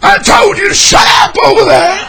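loud, high yelling back; angry yell. Acted. Preacher yelling back at his own grudge in the dungeon. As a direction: snap shut up at the nag. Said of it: "yelling at grudge again".

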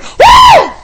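high loud woo; whoop. Shown as a demonstration. Preacher showing the congregation how he shouts, high woo. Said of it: "I like going".

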